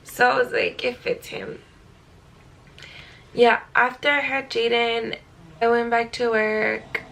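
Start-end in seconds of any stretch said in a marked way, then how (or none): 0.8-1.6 quiet, tender whisper; soft whisper. Retold or imitated. Mother whispering about her newborn's name, tender. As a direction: breathe it softly.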